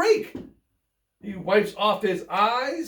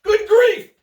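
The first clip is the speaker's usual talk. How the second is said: high, loud startled cry; gasped exclamation; 12 semitones higher, 10 dB louder than usual. Acted. Charlie Brown spotting his old friend, shocked gasp.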